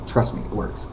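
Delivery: softly whispered tip; whispered aside. Real, not acted. Confiding advice to viewers about arguments, whispered. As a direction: lean in and whisper it.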